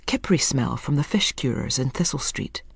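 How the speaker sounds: ordinary delivery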